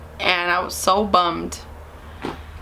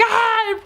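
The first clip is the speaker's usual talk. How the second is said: high, contained mock shriek; imitated yell, held back a little; scream; 12 semitones higher than usual. Repeats mom's scream.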